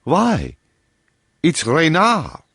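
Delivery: catch in voice. A narrator's reading. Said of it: "with a catch in his voice".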